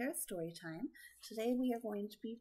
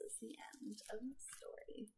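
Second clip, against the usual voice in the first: whisper; 9 dB quieter than usual. Acted. Story ending.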